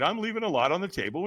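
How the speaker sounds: ordinary talk, nothing marked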